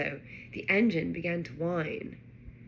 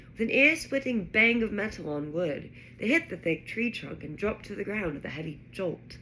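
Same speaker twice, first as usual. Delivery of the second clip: ordinary delivery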